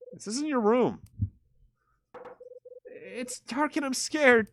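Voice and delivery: put-on high, slow frightened child voice; scared Melissa. Girl hiding in the dark room, slow high scared voice. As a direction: drag it out, small and afraid. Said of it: "That's Melissa's voice".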